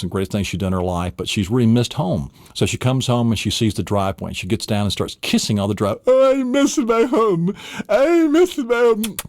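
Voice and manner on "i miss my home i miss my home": put-on high, slow old-lady lament; wailing grandma. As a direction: drag it out like a homesick granny. Grandmother kissing driveway.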